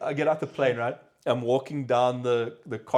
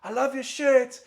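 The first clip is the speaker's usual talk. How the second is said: high, louder quoted woman's voice; imitated mom; 13 semitones higher, 6 dB louder than usual. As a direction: do the mom gushing, pitched way up. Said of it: "She was like".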